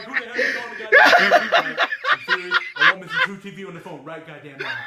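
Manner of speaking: loud, fast, laughing mock rant; mock angry grandpa. Son imitating grandpa yelling at truTV, loud mock rage. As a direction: rant like a furious old man demanding the network.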